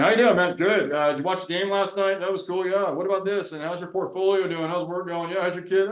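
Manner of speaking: a hint of a casual dude catching up with a buddy; guy small talk. Man calling his friend to chat, lightly voiced casual guy. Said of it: "We call our friends and".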